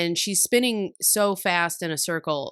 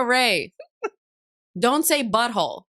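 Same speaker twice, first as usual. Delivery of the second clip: louder scolding parent; 5 semitones higher, 4 dB louder, slower than usual. Retold or imitated. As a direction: scold the child, half laughing. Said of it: "I go".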